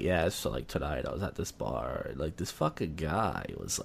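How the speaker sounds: low, hushed self-conscious voice; paranoid, furtive low tone. Shown as a demonstration. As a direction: drop it low and quiet. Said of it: "I'll talk lower, and then I realize my voice changes".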